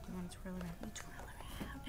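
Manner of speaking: whisper. Acted. Aunt reading to the toddler, soft whisper. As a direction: coax the baby in a hush.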